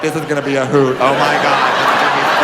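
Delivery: low, giggly gossip voice; smug giggling friends. Retold or imitated. Friends in the front row giggling at the setup, lightly voiced. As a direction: chuckle through it like you're in on the joke. Friends smugly anticipating the prank.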